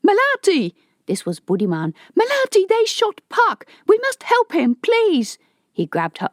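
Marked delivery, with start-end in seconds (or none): 0.1-0.7 much higher, loud frantic call. Acted. Budiman calls Malati after the shooting, frantic. 2.2-5.4 panicked pleading. Acted. Budiman begs Malati to help his shot friend, frantic.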